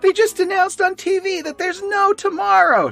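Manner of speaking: very high, hammed-up panicked yell; Sally. Little girl yelling at Linus, mock-frantic high yell. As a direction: shriek it in a cartoon falsetto. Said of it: "She's yelling at Linus".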